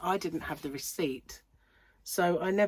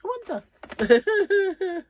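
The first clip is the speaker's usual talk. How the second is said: mock wondering; 13 semitones higher, 8 dB louder, much slower than usual. Mock wondering after friend anecdote.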